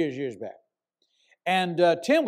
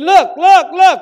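higher and loud, urgent imitated yell; retold yell; 10 semitones higher, 13 dB louder, slower than usual. Prophet to the pastor at the hotel window, an urgent yell. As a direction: shout it to make him turn and look. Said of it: "He had his eyes real big".

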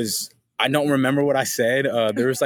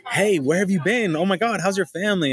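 friendly greeting; 7 semitones higher than usual. Retold or imitated. People greeting him at dispensaries.

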